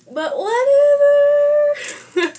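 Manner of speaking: high, loud, slow whatever; dramatic whatever. Pretend. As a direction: stretch it out in mock despair.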